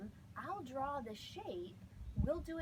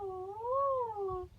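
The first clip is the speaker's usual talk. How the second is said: vocal glide; 8 semitones higher, 6 dB louder than usual. Shown as a demonstration. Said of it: "my rock path sounds like this".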